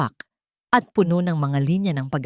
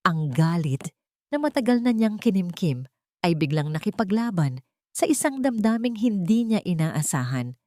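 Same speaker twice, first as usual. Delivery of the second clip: usual speaking voice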